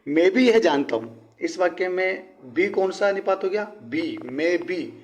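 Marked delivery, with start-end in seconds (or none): 0.1-1.4 slow and louder, leaning on भी; emphatic reading. Shown as a demonstration. Teacher showing students the stressed particle, emphatic. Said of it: "विशेष रूप से इसके ऊपर जोर दिया जा रहा है". 4.2-4.9 very slow, drawing out मैं भी; stress on भी. Shown as a demonstration. Teacher isolating the particle for students, slowly. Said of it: "विशेष रूप से इसके ऊपर जोर दिया जा रहा है".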